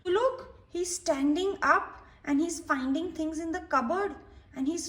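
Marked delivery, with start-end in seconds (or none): none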